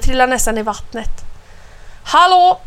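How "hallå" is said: high, loud shout for attention; calling out. A narrator's reading. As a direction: call out to a rowdy group. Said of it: "ropar Anna". (högt rop)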